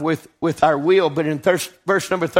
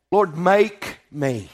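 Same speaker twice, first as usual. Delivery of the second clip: slow earnest prayer; 3 semitones lower, much slower than usual. Retold or imitated. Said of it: "he said".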